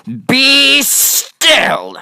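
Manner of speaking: high, loud order that stops the monsters cold; loud, high and strange command; commanding shout. A narrator's reading. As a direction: bark it like a tiny king. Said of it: "till Max said".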